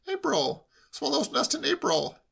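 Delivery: high, insistent correction; know-all nephew. A narrator's reading. Nephew instructing uncle on swallows, know-all. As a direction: pipe up with the fact.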